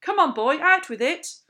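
high, very loud demand; parade-ground shout. A narrator's reading. Captain pressing a pupil to confess, shouting. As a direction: shout as if drilling soldiers. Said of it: "He was shouting at me".

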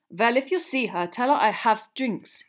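put-on loud, accented surly message; surly foreign student. A narrator's reading. Foreign student leaving message for his date, surly. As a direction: give a gruff foreign-accented order.

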